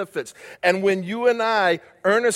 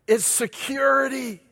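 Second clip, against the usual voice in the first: It's security, slower and higher; emphatic restatement; 6 semitones higher than usual. Shown as a demonstration. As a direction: lift and stretch security. Repeated, lifted and slowed.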